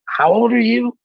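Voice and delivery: high-pitched mock disbelief, pitch climbing; retold puzzled boss; imitated incredulous question. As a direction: ask it as a baffled boss, voice jumping up. Imitates Jeff stopping to ask.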